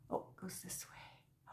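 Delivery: soft whisper to herself; quiet hushed aside. Real, not acted. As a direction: murmur it under your breath. Fixing gift, aside.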